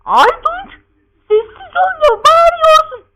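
very high, loud falsetto mother voice; scolding mother. Acted. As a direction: screech it like a fed-up mother.

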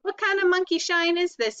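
high-pitched grumpy rat character voice; indignant growl. A narrator's reading. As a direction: growl it like a rudely woken rat. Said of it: "he growled".

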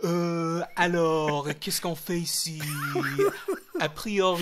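loose-lipped mock French; French with slack lips and drawn-out endings; exaggerated French accent. Shown as a demonstration. Showing how to speak in France, exaggerated French accent. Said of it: "you gotta loosen your lips".

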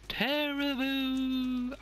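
very slow, stretched-out Terrible; drawn-out dread. Acted. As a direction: drag Terrible out like a groan of doom. Repeating the word, drawn out.

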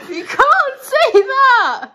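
shocked. Real, not acted. Shocked at racist joke.

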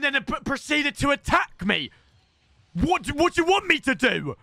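furious rant at Matt for sniping him; angry rant. Real, not acted. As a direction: tell off the sniper. Angry rant at stream sniper.

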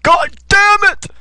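high, loud, slow cry of defeat; mock frustrated yell. Player retelling his death, loud mock yell. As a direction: yell it as you die. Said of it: "I'm like".

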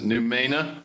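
"Noumena" tried out as a question; unsure pronunciation. Real, not acted. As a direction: say "Noumena" tentatively, asking if it's right. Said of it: "don't even know how to pronounce it".

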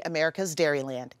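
ordinary talk

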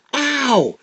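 squeaky ow of pain; sharp high yelp. Acted. Bitten little alligator.

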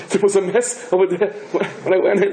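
high, laughing through the words; can't hold back the laugh telling it; laughing while telling. Real, not acted. Telling the cemetery joke, laughing through it.

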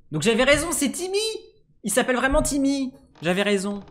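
high, loud gleeful I-was-right; triumphant excitement. Real, not acted. Gamer discovers the character is really Timmy, excited cheer. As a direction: crow with delight at being right.

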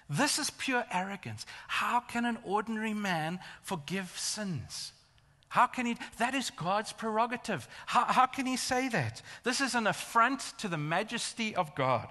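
high-pitched, mounting indignation; acted outrage. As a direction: protest as a scandalized religious teacher. Voices outraged thoughts of the teachers.

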